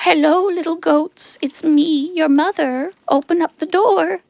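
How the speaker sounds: wolf sweetening his voice to fool them. A narrator's reading.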